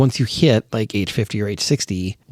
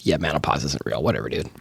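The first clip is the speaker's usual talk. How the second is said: dismissive scoff; 9 semitones lower, 7 dB quieter than usual. Retold or imitated. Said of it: "half joking, half serious".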